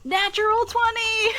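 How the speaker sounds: high, loud cheer; excited shout. Real, not acted. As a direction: yell it in triumph. Rolling a natural twenty.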